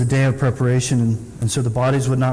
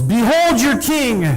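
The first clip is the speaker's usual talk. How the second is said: higher, slow, grand announcement from the judge's seat; mock proclamation; 8 semitones higher, 3 dB louder, slower than usual. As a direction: present him with a sweep. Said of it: "and yells".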